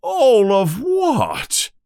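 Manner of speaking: high, drawn-out outraged yell; slow, high-pitched indignant cry. A narrator's reading. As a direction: stretch each word in an incredulous yell. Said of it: "Hagrid yells".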